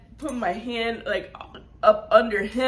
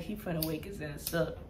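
much softer, low under-breath line; muttered aside; 6 semitones lower, 11 dB quieter than usual. Retold or imitated. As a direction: mumble it to yourself so he won't hear. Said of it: "'cause I'm like".

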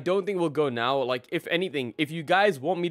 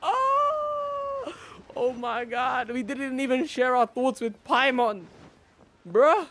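excited; 9 semitones higher, slower than usual. Real, not acted. Overwhelmed returning to game story.

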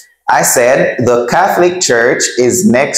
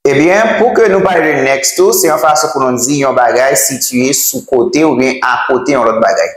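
normal talking voice

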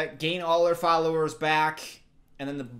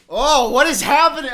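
loud, high cry of bewilderment; overwhelmed wail; 8 semitones higher, 15 dB louder than usual. Real, not acted. Viewer baffled by own emotions, loud overwhelmed wail. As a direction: wail it out, losing composure.

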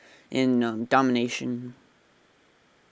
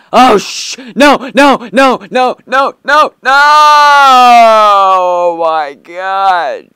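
rising panicked shout of no, no, no; real alarm, loud and high; 7 semitones higher, 15 dB louder, much slower than usual. Real, not acted. Gamer yelling at a creeper about to blow, panicked. Creeper explodes during play.

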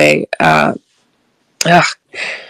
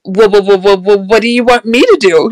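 repeated stammered first syllable, pitched up; stuttering; 3 semitones higher than usual. Retold or imitated. Woman looking up to ask him what he wants, stuttering. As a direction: imitate getting stuck on the opening word. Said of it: "I stutter again".

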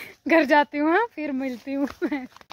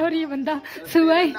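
laughing through the words; giggling as she points at him; 6 semitones higher, 4 dB louder than usual. Real, not acted. Laughing at the man sipping tea on result day.

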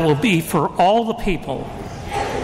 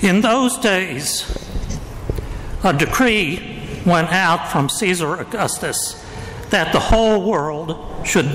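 Gospel reading. Acted. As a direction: proclaim it like a liturgical reading.

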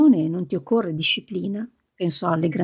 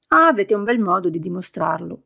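ordinary delivery

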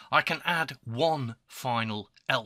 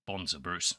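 put-on Australian accent; 3 semitones lower, 5 dB quieter than usual. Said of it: "the myth that most male Australians".

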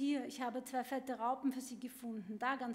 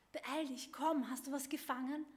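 ordinary delivery

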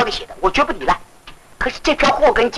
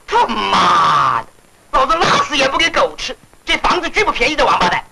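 furious cursing; 3 semitones higher than usual. Acted. Landlord vows not to sell cheap, furious cursing. Landlord curses after the quarrel.

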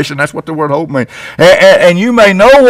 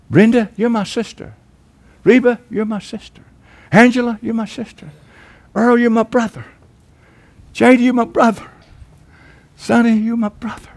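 tender address; 5 semitones higher, 6 dB quieter than usual. Acted. Naming congregation as siblings.

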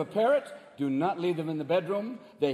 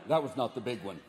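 earthquake expert; 8 semitones lower than usual. Retold or imitated. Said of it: "And they went".

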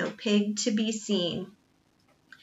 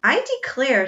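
genuine surprise; 4 semitones higher, 5 dB louder than usual. A narrator's reading. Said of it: "said Praiseworthy in genuine surprise".

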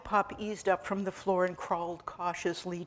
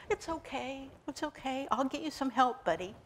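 reassuring; 6 semitones higher, 4 dB quieter than usual. Acted. Comforting a dying man.

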